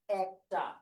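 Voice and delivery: একটা drawn out as "æk-ta"; open-এ একটা. Shown as a demonstration. Teacher showing what we actually pronounce, open "æk-ta" demo of একটা. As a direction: say একটা slowly, first vowel open like "cat". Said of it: "এরকম".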